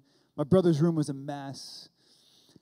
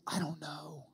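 whisper; 8 dB quieter than usual. Retold or imitated. Brother's awed reply in story.

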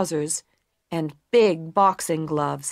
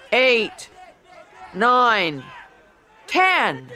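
referee count; 3 semitones higher, much slower than usual. A narrator's reading.

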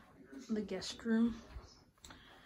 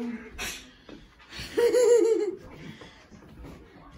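loud laugh; 13 semitones higher, 11 dB louder than usual. Real, not acted. Kid laughs during house tour.

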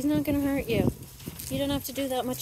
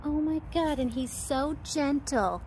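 normal talking voice

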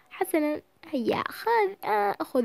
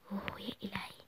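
soft whispered oh my god; whispered gasp; 5 semitones lower, 18 dB quieter than usual. Acted. Girl reacting quietly while hiding, whispering. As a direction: breathe it under your breath.

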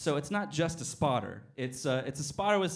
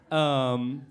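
normal talking voice

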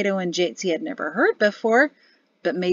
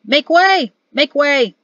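much higher and louder, a bit too eager; shouting; 7 semitones higher, 7 dB louder, slower than usual. A narrator's reading. Coach driver shouting at traffic to clear the way. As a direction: bellow it to clear the road. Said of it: "cracked his whip and shouted".

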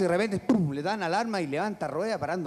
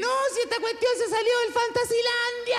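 very high screamed reply; built-in scream. Acted. Runaway ride's driver explaining to the cop, very high scream. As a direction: screech the whole line up high. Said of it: "en vez de turbo lleva grito incorporado".

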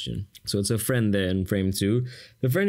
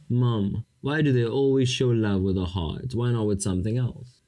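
ordinary talk, nothing marked

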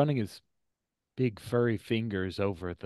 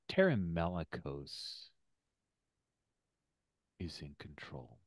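ominous scene setting; 4 semitones lower, 4 dB quieter than usual. Acted. Game master reveals who controls the Wookiee, ominous and hushed. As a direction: let the reveal sink in, dropping very soft.

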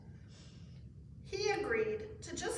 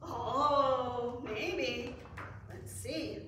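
ordinary delivery, nothing marked

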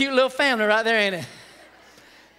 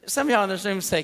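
normal talking voice

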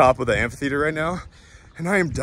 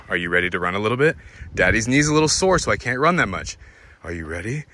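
ordinary delivery

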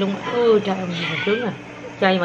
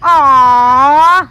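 loud, high drawn-out aww; cooing "aww"; 4 semitones higher, 10 dB louder than usual. Real, not acted. Told the children hugged, loud cooing. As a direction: let out a big, adoring aww. Hearing of the children's big hug.

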